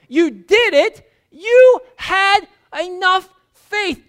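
joyful Jesus, laughing on little; delighted praise. Acted. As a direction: cheer Peter on like a proud coach. Jesus praising Peter's faith.

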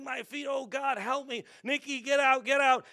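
high-pitched mock panicked yelling; retold screaming and yelling. Preacher reliving burned feet and warning Nikki, mildly yelling. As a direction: act out frantic cries, only partly at full volume. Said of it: "I'm screaming and yelling".

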